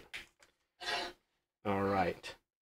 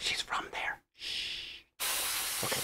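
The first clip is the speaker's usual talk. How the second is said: whisper; 15 dB quieter than usual. Pretend. Joking secret about his wife.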